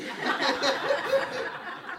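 a high, airless giggle after the joke; high breathless laugh. Real, not acted. Laughs after own punchline.

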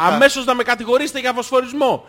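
loud aggrieved outburst; shouting. Real, not acted. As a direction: protest loudly, building to a drawn-out yell. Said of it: "Μη φωνάζεις, ρε μαλάκα".

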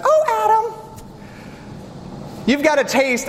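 high, swooning mock-seductive wife; a high, sugary falsetto Eve; high breathy Eve voice. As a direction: coo it up high like a temptress. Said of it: "I'm not gonna talk like that anymore".

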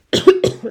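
harsh sudden cough; chesty cough. Real, not acted. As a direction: cough hard mid-sentence. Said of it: "scusate per la tosse".

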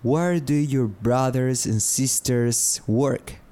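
slow, careful "Where do you live?"; slow teaching model. Shown as a demonstration. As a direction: say "Where do you live?" slowly for learners to copy. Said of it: "puedo preguntar algo como".

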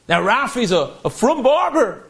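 ordinary talk

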